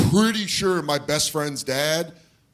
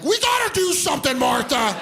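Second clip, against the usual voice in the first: high, urgent frantic pleading; panicked dad; 10 semitones higher than usual. Retold or imitated. Dad in bed pleading with his wife Martha, high and frantic. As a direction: beg your wife to act, frantic.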